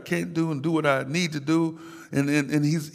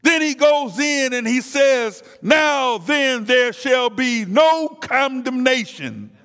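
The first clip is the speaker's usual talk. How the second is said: preaching at full force; 4 semitones higher than usual. Real, not acted. Preacher drives the congregation toward deliverance, full-force preaching. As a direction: build it up like a revival climax.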